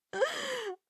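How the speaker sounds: high giddy little laugh; a burst of happy disbelief in a laugh. Acted. To the crush after a yes, giddy high laugh.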